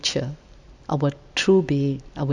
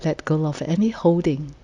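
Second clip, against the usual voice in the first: guided meditation; 4 dB quieter than usual. Real, not acted. Meditation leader inviting the group to settle and let go, calm and unhurried. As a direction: gently lead sitters into rest, phrase by phrase.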